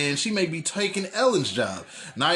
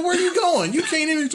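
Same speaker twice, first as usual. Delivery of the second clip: exasperated; 11 semitones higher than usual. Retold or imitated. Retold argument with drunk friend.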